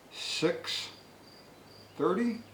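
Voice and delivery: high, incredulous "thirty?"; tally up and balk at the total; disbelief. Real, not acted. Adding up the lawnmower bill, incredulous and high.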